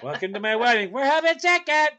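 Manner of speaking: high, sing-song bridezilla voice; mock bride. Bride announces her menu to guests, hammed-up and smug. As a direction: preen it like a spoiled bride.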